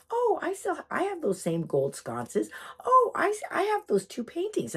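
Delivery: high exclamations with drawn-out phrases; imitating delighted recognition. Guest spotting familiar antiques, imitated high delight. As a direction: recognise each treasure, leaping up on the "oh". Lady claims the same belongings.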